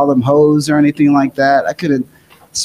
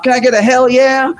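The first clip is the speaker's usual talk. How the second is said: lightly voiced wrestler rallying the crowd; a hint of a wrestler hyping a crowd; Stone Cold hype; 7 semitones higher than usual. Wrestler asks the rally crowd for a hell yeah, hammed-up. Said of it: "Stone Cold is sitting there going off".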